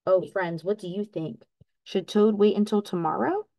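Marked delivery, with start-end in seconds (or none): none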